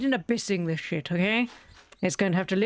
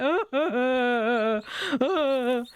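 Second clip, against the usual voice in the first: high squawking chick cries imitated; mock chick screams; 5 semitones higher, much slower than usual. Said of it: "They're like".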